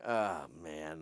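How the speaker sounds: low, soft retold groan of unease; quiet dismay. Onlooker watching a man get abused, quiet dismay. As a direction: sigh it under your breath.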